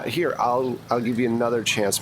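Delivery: ordinary talk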